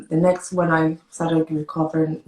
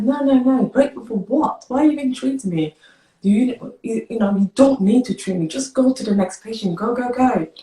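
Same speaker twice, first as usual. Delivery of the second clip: higher, louder, agitated reenactment; retold tearful protest; 7 semitones higher, 4 dB louder than usual. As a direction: act out waving the doctor away, upset. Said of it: "and I was crying".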